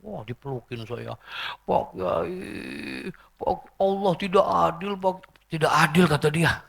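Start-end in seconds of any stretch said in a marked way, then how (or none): 1.7-6.0 high-pitched widower's lament; mock grieving wail. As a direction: imitate a bereaved husband wailing to his kiai. Acted grieving widower protesting to kiai. (ratapan duda yang didramatisir)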